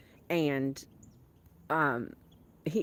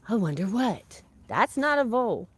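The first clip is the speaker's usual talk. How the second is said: ordinary talk, nothing marked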